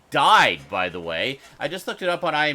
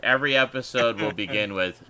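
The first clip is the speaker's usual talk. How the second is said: usual speaking voice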